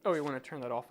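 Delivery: normal talking voice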